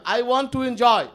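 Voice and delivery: loud, insistent declaration; firm declaration. Retold or imitated. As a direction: claim it boldly. Bhagwan orders Yog Maya away.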